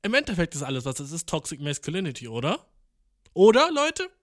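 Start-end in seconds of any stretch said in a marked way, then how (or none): 3.3-4.1 calls out to the viewers, much higher and louder; a loud, high rising question thrown at the viewers. Real, not acted. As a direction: shout the question out to the audience. Calling out to the viewers.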